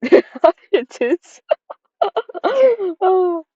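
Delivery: teasing through a laugh; laughing hint. Real, not acted. Nudging the player with a giggly hint. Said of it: "laughing hint hint".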